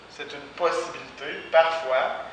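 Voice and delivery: usual speaking voice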